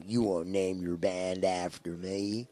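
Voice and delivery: slow, hammed-up Montana accent; a slow Montana accent, imitating the studio manager. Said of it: "That was my best Montana accent".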